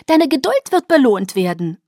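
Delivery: high, loud, singsong promise; high-pitched, lilting pixie voice; chirpy Alice figure. Acted. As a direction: trill a teasing promise.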